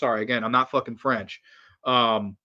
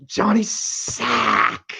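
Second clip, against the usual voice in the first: very slow, stretched out, higher; drawn-out name; 8 semitones higher, much slower than usual. Pretend. Joking bus sign for J P, drawn out slowly. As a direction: stretch the name out like a joke announcement.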